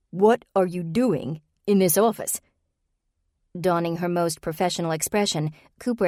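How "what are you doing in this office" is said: slower, pressing repeat of the question; insist, drawing each word out; repeated demand. Acted. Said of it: "he repeated".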